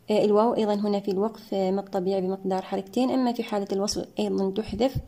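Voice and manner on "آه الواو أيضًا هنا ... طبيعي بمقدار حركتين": ordinary delivery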